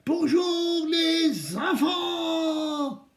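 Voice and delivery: big drawn-out singsong greeting; kids show host. Shown as a demonstration. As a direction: call out to the children like a kids' TV host. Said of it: "attention, vous êtes prêts?". (animateur télé pour enfants, voix chantante)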